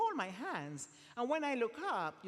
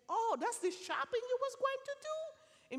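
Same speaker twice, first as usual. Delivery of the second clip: much higher, teasing disbelief; mock surprise. Confronting the man about his shopping plan, teasing disbelief. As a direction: feign shock at his plan, playfully. Reacting to planned stealing.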